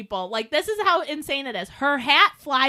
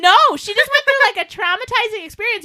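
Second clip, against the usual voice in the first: 1 excited; 7 semitones higher, 8 dB louder than usual. Real, not acted. Excited retelling.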